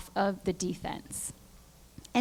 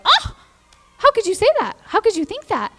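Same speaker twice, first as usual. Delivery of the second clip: much higher, louder indignant gasp that settles down; mock outrage; 12 semitones higher, 6 dB louder than usual. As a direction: ham up the offended shock. Mocking others' offended reaction.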